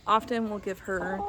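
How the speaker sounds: ordinary talk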